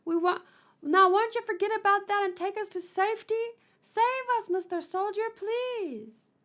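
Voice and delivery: very high, hammed-up begging; hammed-up pleading. Begging the soldier for safety, pleading in high voice. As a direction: beg in a little high voice.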